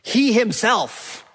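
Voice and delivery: HE HIMSELF hit high, slow and drawn out; emphatic. Shown as a demonstration. Preacher showing the congregation what's emphatic, emphatic. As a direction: punch "He himself" slowly and high, falling at the end. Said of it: "What's emphatic? ... is emphatic".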